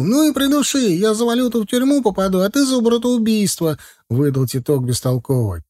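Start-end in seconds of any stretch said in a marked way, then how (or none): 0.0-3.8 very high, heated retort; frantic defiance. A narrator's reading. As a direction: fling it back in a high, agitated voice. Titok defies Zakhar in quarrel.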